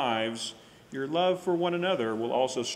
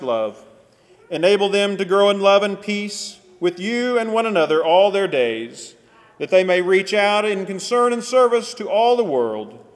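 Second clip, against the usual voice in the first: loud, raised solemn prayer voice; praying aloud; 7 semitones higher, 13 dB louder than usual. Acted. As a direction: proclaim the prayer to the whole room. Leads opening prayer loud.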